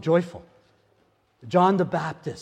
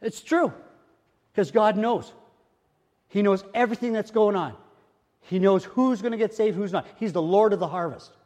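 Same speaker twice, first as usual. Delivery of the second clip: higher pitched, rising preacher fervor; fervent preaching; 6 semitones higher than usual. Acted. Preacher stirring the church with fervent rising preaching. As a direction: lift the congregation, climbing.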